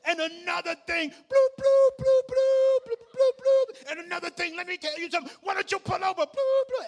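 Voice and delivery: very high, loud garbled phone tirade; ranting voice through speaker. Retold or imitated. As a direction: squawk a furious rant as muffled gibberish. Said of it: "all I heard was".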